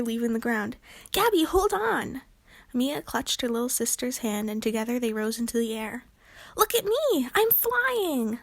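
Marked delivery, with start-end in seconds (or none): 1.1-2.2 urgent call. Acted. Calling to sister while rising. 6.6-8.4 high, joyful cry of wonder; excited cry. Acted. Lainey floating up, crying out in delight. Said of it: "Lainey cried".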